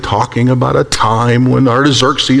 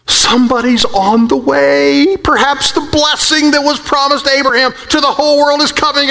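excited realization; 10 semitones higher than usual. Acted. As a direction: let the hope build as he realizes someone is coming. Isaiah realizing joyfully.

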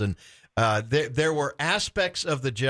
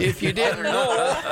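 laughing; 9 semitones higher, 4 dB louder than usual. Real, not acted. Host laughs at guest's reply.

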